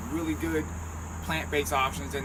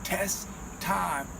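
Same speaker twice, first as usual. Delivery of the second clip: drawn-out announcer catchphrase; 7 dB quieter, much slower than usual. Acted. Catchphrase intro chant.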